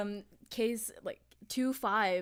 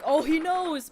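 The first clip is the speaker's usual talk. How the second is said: much louder, higher quoted outburst; retold excited realisation; 6 semitones higher, 11 dB louder, slower than usual. Recalling own reaction while playing, excited outburst.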